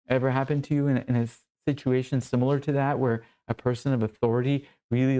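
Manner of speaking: ordinary talk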